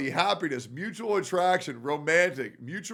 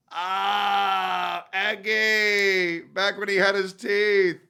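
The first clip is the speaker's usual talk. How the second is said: higher, louder delighted gush; delighted cry; 5 semitones higher, 4 dB louder, slower than usual. Real, not acted. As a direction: squeal with joy at the cat. Sees beloved cat on stream.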